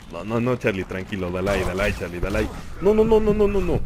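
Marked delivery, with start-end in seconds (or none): 2.8-3.8 panicked no. Real, not acted. Game about to kill him.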